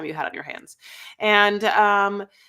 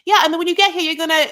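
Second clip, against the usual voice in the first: high, louder bossy voice; mock girlfriend; 9 semitones higher, 7 dB louder, much faster than usual. Girlfriend insisting he repay the loan, high bossy mock. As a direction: demand it like she's owed. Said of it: "she's like".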